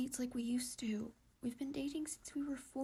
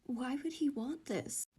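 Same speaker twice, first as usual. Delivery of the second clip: usual speaking voice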